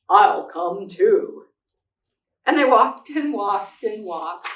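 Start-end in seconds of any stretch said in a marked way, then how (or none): 0.1-1.5 low, loud and slow bear voice. Acted. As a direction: rumble it out slowly like a big bear. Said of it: "And Bear said".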